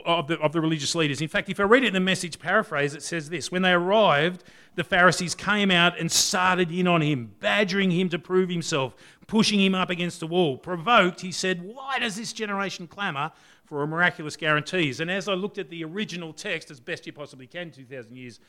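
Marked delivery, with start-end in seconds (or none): 3.5-15.0 scripture reading. Acted. Reading the Message paraphrase aloud.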